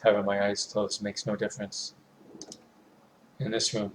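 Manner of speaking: ordinary delivery, nothing marked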